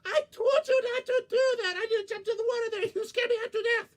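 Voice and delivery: high, loud, fast frightened squeal; startled scolding. Acted. Borgita scolding Coyote for scaring her, high and shrill. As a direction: shriek at him for the scare.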